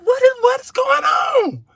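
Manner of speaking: high drawn-out panicked cry; comic panic. Retold or imitated. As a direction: wail in mock terror.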